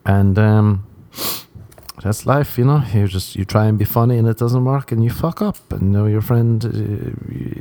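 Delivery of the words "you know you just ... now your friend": normal talking voice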